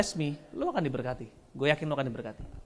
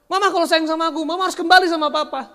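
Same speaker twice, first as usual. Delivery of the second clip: put-on whiny child; 8 semitones higher, 7 dB louder, faster than usual. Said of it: "Kita suka paksa".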